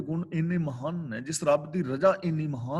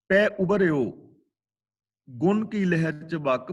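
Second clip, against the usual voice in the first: slow, raised, reverent recital; slow verse recitation; 3 dB louder, slower than usual. Shown as a demonstration. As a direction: lift and intone the line slowly. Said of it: "ਅਗਲਾ ਬਚਨ".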